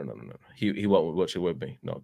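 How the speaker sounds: ordinary talk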